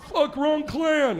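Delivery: high, loud, slow yelp of a fleeing Klansman; panicked Klansman. Acted. Klansman fleeing the [name] crowd, high panicked yelp. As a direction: shriek it out while running away.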